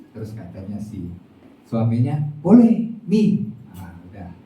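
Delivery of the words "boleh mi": husband's reply. Acted. Husband answers wife kindly.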